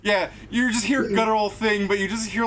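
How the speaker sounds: ordinary delivery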